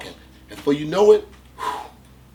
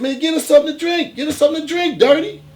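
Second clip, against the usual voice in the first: high, loud call to his friend; loud buddy order; 13 semitones higher, 7 dB louder, faster than usual. Retold or imitated. In the store, loudly urging the man to buy liquor. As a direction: loudly push him to get a drink. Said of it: "I'm loud as shit".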